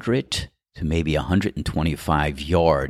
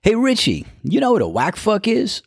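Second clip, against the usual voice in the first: much higher put-on caddie voice; imitating Cloudy. Golfer mimics caddie asking about a video, high voice. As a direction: squeak it out like the friend. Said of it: "He goes".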